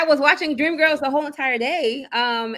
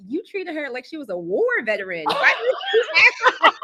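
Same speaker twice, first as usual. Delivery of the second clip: laughing mock reproach, climbing high; high, incredulous laughing imitation; retold teasing scolding; 5 semitones higher, 4 dB louder than usual. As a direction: scold through rising laughter.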